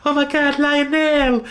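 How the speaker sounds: high, airy falsetto cry; hammed-up star in mock shock. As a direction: exclaim it in put-on falsetto. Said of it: "He'd be like".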